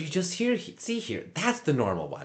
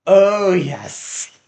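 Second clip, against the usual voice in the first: booming grand "Oh, yes"; hammed-up dramatic welcome; theatrical loud greeting; 7 semitones higher, 12 dB louder than usual.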